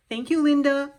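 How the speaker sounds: bright, loud burst of gratitude; excited thanks. Real, not acted. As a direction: call out your thanks to Linda. Thanking the gift sender.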